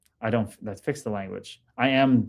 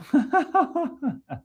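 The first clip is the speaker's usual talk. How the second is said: a sudden squeal of laughter, much higher; high burst of laughter; 13 semitones higher than usual. Real, not acted. Laughing at a chat line.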